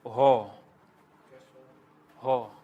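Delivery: ho drawn out slowly with a breathy h; slow demo ho. Shown as a demonstration. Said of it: "Then it's your ho".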